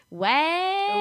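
high, drawn-out mock "what?"; baffled non-dancer. Non-dancer missing the dance joke, baffled. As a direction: stretch it upward in puzzlement. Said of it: "They're just like".

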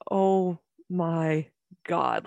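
very slow, drawn-out disbelief; retold stunned reaction. Sitting watching the mirrored moments, slow stunned disbelief. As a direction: stretch out each word in awe.

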